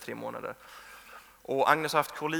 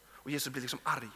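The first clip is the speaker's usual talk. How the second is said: ordinary delivery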